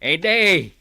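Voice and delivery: calling loudly to wake Ede. Acted. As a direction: shout his name to rouse him. (malakas na pagtawag kay Ede)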